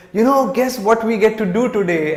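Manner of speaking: put-on childlike enthusiasm. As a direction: tease the surprise happily. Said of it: "she was just enthusiastic".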